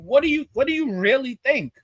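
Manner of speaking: normal talking voice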